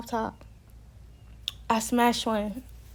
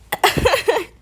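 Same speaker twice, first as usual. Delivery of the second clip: high, loud burst of laughter; 10 semitones higher, 8 dB louder than usual. Real, not acted. Storyteller laughs at their own warning, loud high cackle.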